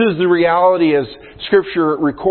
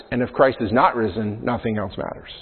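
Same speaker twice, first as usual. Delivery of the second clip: softer, dropping to near silence on "matters"; hushed contrast; 4 semitones lower, 5 dB quieter than usual. Real, not acted. Pastor reading the historian's quote, second half hushed. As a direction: let the bleak half fade away. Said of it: "it says".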